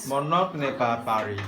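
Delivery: fast, run-together "Mon oncle n'est pas à Paris"; brisk, connected syllables; quick model sentence. Shown as a demonstration. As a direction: rattle it off in one go. Quicker take of the slow model.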